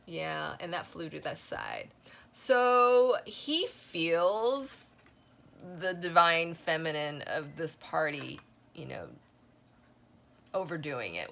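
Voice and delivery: ordinary talk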